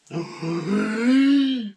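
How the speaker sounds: hammed-up deep in-breath; huge gasp. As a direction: suck in a giant breath. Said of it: "took an even deeper breath".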